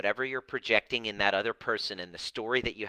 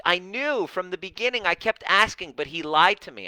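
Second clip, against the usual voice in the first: indignant insistence; 9 semitones higher, 6 dB louder than usual. Retold or imitated. Participant insists husband lied.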